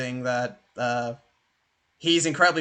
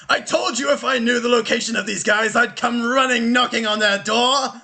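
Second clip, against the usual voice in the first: high, hearty warrior king voice; boastful Viking king; 6 semitones higher, 3 dB louder than usual. Acted. Viking king calls back to the party, boasting heartily. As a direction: call out proudly, pitched well up.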